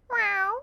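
high imitated cat meow; cat's meow. Storyteller imitates the cat greeting the parrot, high meow. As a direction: mew like a cat coming up. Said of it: "the cat comes up and goes".

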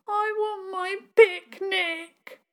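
put-on high, slow whine of a small child; tearful little girl. Littlest girl wanting her lost picnic, high slow whine. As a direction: drag it out like a child about to cry. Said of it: "and started to cry".

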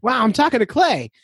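imitating a starstruck fan, high-pitched; excited fan. Host imitates a fan who reached him by phone, high and excited. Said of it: "excited to talk to me".

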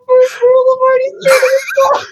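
First rule shouted, breaking up. Shown as a demonstration. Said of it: "repeated louder, crying out".

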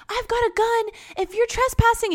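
high-pitched mock warning; hammed-up high threat; threatening neighbor. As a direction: imitate a neighbor warning off a trespasser. Voicing neighbor threatening trespasser.

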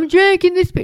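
hammed-up frenzied churchgoer; scornful imitation of a worshipper, pitched high; mocking a worshipper in a frenzy.

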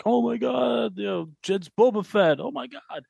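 excited. Retold or imitated. Fan reacting to big reveal.

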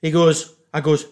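ordinary talk

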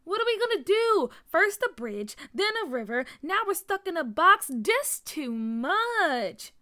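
exasperated panic. A narrator's reading. Tia stuck in a box, exasperated panic. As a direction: complain loudly, stretching the last words. Trapped child lists troubles.

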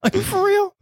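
very high-pitched mock disbelief; incredulous cab driver. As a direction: squeak it out as a stunned cabbie, half laughing. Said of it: "cab driver's face, just mouth open".

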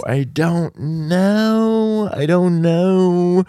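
very slow, high, stretched-out words; drawn-out uncertainty. Real, not acted. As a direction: hold each "know" out long and high. Drawn-out answer about moving back.

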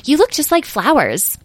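high-pitched admiring wonder; delighted exclamation. A narrator's reading. Child admires the fairies' colors, high and delighted. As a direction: marvel in a high voice. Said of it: "exclaimed Edwin".

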